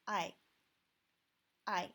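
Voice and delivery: "I" said as the pinyin final ai, a clear glide. Shown as a demonstration. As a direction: say "I" like the English eye. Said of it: "it should be read as".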